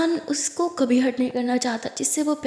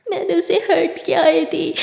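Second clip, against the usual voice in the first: crying; 4 semitones higher, 5 dB louder than usual. Acted. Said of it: "रोने लगती है".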